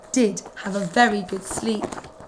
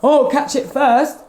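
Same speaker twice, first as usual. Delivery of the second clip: dog's thought; 4 semitones higher, 4 dB louder than usual. Acted. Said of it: "thought the first dog".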